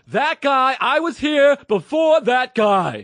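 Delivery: high, loud, slow shouted complaint; angry customer impression. Customer shouting that he was there first, high slow yell. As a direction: shout the grievance slowly and shrilly. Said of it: "I got it that time".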